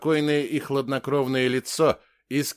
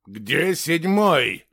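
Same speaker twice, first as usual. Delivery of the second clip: slow, louder angry demand; 4 semitones higher, 5 dB louder, much slower than usual. A narrator's reading. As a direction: draw the question out with anger, higher. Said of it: "Злость чувствовалась в голосе Магнуса". (злой, требовательный вопрос)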